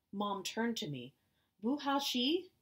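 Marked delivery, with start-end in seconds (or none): 1.6-2.5 Chinese. Acted. Mother asks in Chinese.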